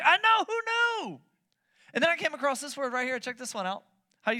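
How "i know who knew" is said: high, loud, drawn-out disbelief; mock amazement. Preacher reacting to the new zoology word, in mock amazement. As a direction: squeal it up high, astonished.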